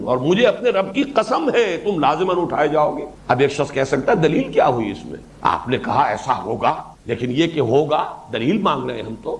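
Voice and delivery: ordinary talk, nothing marked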